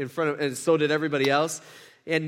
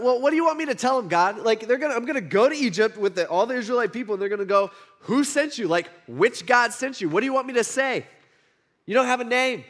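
higher-pitched, agitated questioning; exasperated protest; 5 semitones higher, 4 dB louder than usual. Retold or imitated. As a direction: protest like an overwhelmed, reluctant recruit. Preacher voices Moses protesting to God.

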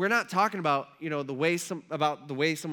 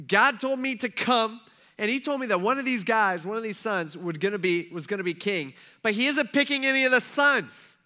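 higher, louder exasperated complaint; baffled protest; 5 semitones higher, 5 dB louder than usual. Acted. Samuel baffled that no son is picked, exasperated. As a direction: protest in mounting bewilderment.

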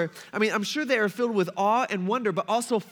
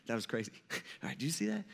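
low, hushed aside with a small laugh; easing up; 9 semitones lower, 12 dB quieter, much faster than usual. Acted. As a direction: murmur it to a buddy in nervous relief. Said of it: "getting a little easy".

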